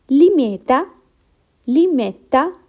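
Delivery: limetta said lee-MET-tah, crisp double t; Italian word model. Shown as a demonstration. Teacher modelling the Italian word for learners to copy. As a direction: model limetta clearly for repetition. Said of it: "Focus on the pronunciation".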